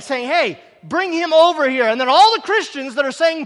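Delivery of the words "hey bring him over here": loud, higher call across the road. Retold or imitated. Jesus calling for the blind man, loud. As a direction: call him over loudly. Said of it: "and calling".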